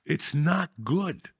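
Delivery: slowly, heavy and dismayed; slow, sad. Real, not acted. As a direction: drag it out in dismay. Slow repeated lament.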